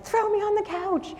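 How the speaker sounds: high, desperate exclamation; wild-eyed plea. Retold or imitated. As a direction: blurt it out, frantic and exhausted. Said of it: "wild-eyed".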